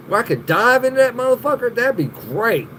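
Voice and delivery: ordinary talk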